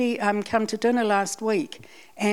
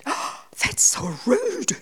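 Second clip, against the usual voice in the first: high, drawn-out mock gasp of outrage; imitating a shocked listener, pitched up; shocked disapproval; 7 semitones higher, slower than usual. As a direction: act out a scandalised friend. Shocked friend's reaction acted out.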